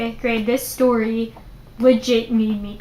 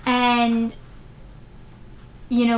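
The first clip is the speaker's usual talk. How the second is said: ordinary talk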